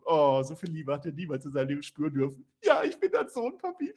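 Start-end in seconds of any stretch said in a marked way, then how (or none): 2.6-3.9 tearful son. Acted. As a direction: melt into a weepy high plea. Dom tearful reunion.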